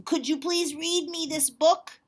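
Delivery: slow, high, loud pleading; Duncan asking sweetly. Acted. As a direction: stretch out the please. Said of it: "he said to the possum".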